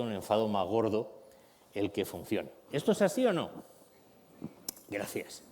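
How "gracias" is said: ordinary talk, nothing marked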